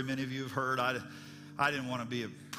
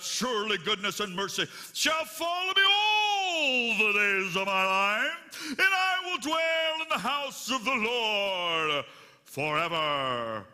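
booming overdramatic shout, much higher and slow; grand theatrical finale; 7 semitones higher, 7 dB louder, slower than usual. Pretend. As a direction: belt the ending like a stage climax. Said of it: "he was a drama teacher, and he was gonna give it all he had".

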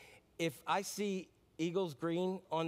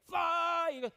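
mock yell; 10 semitones higher, 3 dB louder, slower than usual. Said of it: "I'm like".

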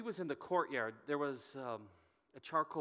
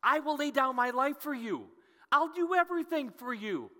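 much higher and louder, fervent declaration; bold, brazen vow; 12 semitones higher, 10 dB louder than usual. Acted. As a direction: proclaim it like a bold, rash disciple. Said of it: "being the bold and brazen person that he is".

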